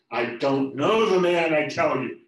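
loud, slow, emphatic denial; loud final denial. Acted. Peter denying Jesus a third time, loud and desperate. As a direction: shut the accusation down hard.

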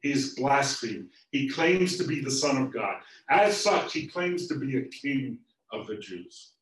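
loud, high charge, slowing on key words; loud accusation. Acted. Chief priests accusing Jesus to Pilate, loud and indignant. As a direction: denounce him to the governor.

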